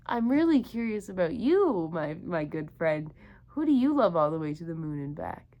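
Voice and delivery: usual speaking voice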